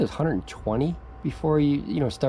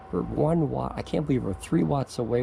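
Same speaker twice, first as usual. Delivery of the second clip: ordinary delivery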